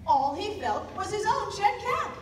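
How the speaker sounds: sudden shock as he finds only one cap; alarmed discovery. A narrator's reading. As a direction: voice jumps high and loud in alarm. Realizing caps are gone.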